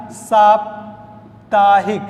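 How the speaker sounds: साप्ताहिक said slowly with a long saa; slow correct pronunciation. Shown as a demonstration. Teacher giving the correct form, slowly. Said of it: "इसका शुद्ध रूप क्या बनेगा".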